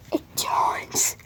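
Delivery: hushed whisper. Acted. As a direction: breathe it out like a secret. Whispered toy detail.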